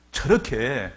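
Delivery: normal talking voice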